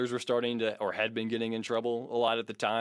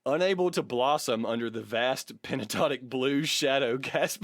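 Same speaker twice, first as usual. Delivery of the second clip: mock-epic bio reading; 4 semitones higher than usual.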